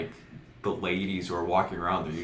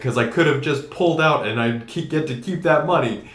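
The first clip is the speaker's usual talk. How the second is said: higher, louder retort to his dad; heated protest; 6 semitones higher, 5 dB louder than usual. Retold or imitated. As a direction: argue back, mildly worked up. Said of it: "I'm like".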